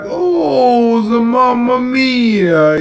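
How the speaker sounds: mock the Italian players with a singsong Italian accent. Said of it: "the Italians".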